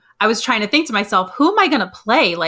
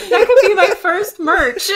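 laughing; 8 semitones higher, 5 dB louder than usual. Real, not acted. Giggling about the joke.

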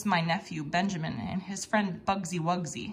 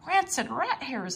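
exclaimed; 6 semitones higher than usual. A narrator's reading. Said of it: "I exclaimed".

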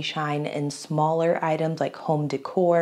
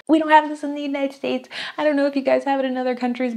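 higher, laughing voice; amused; 8 semitones higher, faster than usual. Real, not acted. Vlogger amused by a cemetery tram stop, laughing. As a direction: still giggling as you talk.

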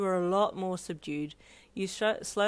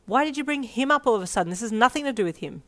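fast, higher defensive burst; defensive rambling; 5 semitones higher, 4 dB louder, faster than usual. Acted. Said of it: "you rambled defensively".

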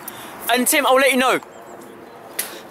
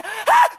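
frustrated yell; 11 semitones higher than usual. Real, not acted.

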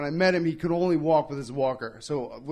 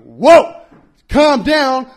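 loud whoa; 6 semitones higher, 12 dB louder, much slower than usual. Retold or imitated. Confronted by tough guy.

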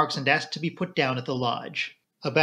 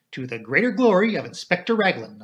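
raised, measured solemn pronouncement; 4 semitones higher, 4 dB louder than usual. A narrator's reading. Doctor answers the Colonel's question, solemnly. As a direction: say it with mock-grand gravity. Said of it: "I said solemnly".

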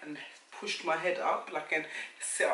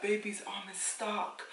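slow urgent; 5 semitones higher, slower than usual. Retold or imitated. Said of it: "She was like".

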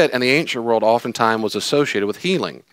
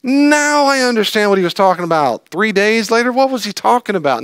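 mock realization; 9 semitones higher, 3 dB louder than usual. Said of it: "to look back after the fact and going".

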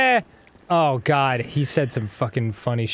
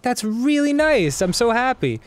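mock sweet; 9 semitones higher than usual. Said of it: "I was like".

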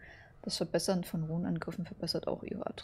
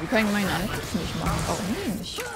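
loud and frustrated; 5 dB louder than usual. Real, not acted. Loud frustration during a fight.

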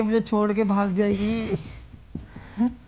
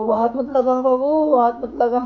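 louder, sharp don't-touch; sharp warning; 4 semitones higher, 7 dB louder than usual. Acted. Injured boyfriend warning girlfriend off his wound, loud and sharp. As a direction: snap it out as she reaches for him.

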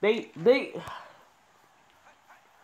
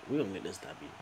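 low determined mutter; 8 semitones lower, 11 dB quieter, faster than usual. Real, not acted. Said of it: "I don't even need to get excited".

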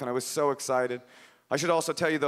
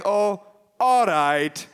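slow, higher, louder put-on woman; reluctant giving in; 5 semitones higher, 6 dB louder, much slower than usual. Filipino woman relenting to a caller, drawn-out reluctant consent. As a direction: give in with a long drawn-out concession.

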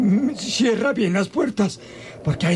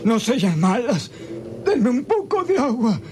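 moaning, parched plea for water; feverish pleading; 2 semitones higher than usual. Acted. Sick father moaning to the maids for water. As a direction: beg weakly, burning with fever.

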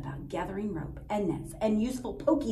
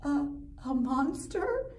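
whimpering; 6 semitones higher, slower than usual. Acted. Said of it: "she whimpered".

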